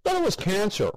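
talking with a mouthful; words round a bite of burger; mouth full. A narrator's reading. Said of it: "he said, mouth full".

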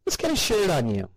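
high, eager housewife voice; chirpy mother proud of her shopping; cheery mother. A narrator's reading. Mother to Grandma.

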